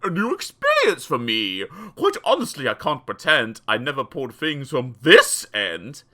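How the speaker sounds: low, loud villain voice; dentist's deep gleeful voice. Acted. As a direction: gloat over the patient. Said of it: "he cried with obvious glee".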